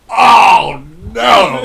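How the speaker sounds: God howling in dismay; anguished God cry. Retold or imitated. God sees Eve bite the apple, wails no. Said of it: "you hear God go".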